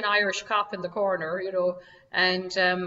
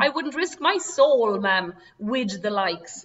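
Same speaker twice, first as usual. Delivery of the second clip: put-on Irish maid's accent; 5 dB louder, slower than usual. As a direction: drag it out like a stubborn Irish maid. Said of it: "you can hear my accent".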